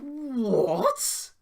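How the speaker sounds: high, loud drawn-out rising "what?"; real disbelief, let it hang; storyteller baffled by the insult, rising loud disbelief; incredulous what. Real, not acted. Incredulous punchline to the rant.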